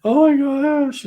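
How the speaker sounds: high, drawn-out tearful wail; imitated crying. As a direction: wail it slowly like a sobbing woman. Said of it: "she's crying and crying".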